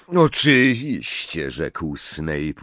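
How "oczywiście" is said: oczywiście louder, higher and slow; loud mocking. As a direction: draw out oczywiście in loud mockery. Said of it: "uśmiechając się drwiąco".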